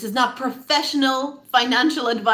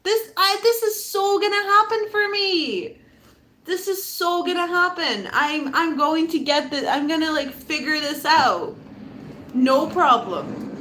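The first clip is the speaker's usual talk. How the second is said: fully embracing it; 5 semitones higher than usual. Shown as a demonstration. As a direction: brush off all doubt with breezy certainty. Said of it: "You need to embrace this".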